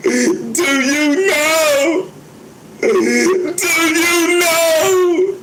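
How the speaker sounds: hoch und langgezogen, gespielt weinerlich; fake crying song. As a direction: wail it very high and drawn-out like a hammed-up sob. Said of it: "I am the greatest actor".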